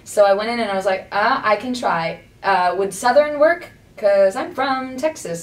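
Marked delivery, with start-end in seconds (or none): 1.1-5.4 put-on slow, drawn-out Texan Southern accent; Texan twang, stretched slowly. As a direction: offer it in a Texas drawl, taking your time. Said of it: "so I used a Southern accent".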